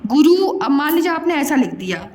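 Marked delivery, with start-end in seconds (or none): 0.1-0.5 गुरु quick and loud, both u's brief; short vowels. Shown as a demonstration. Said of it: "इसमें कम समय लगा".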